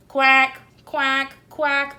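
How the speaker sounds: quacking called out; duck quacks. A narrator's reading. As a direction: call like ducks. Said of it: "they called".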